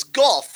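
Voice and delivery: "Goff" said like cough but with a G; "Goff" with a short o, rhyming with cough; name pronunciation. Shown as a demonstration. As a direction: correct the host, "Goff" like cough. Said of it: "like cough, but with a G".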